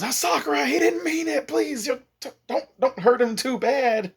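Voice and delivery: very high, stammering plea; hammed-up, high-pitched begging; panicked pleading. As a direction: beg her frantically in a squeaky-high voice. Begging her to spare the boy.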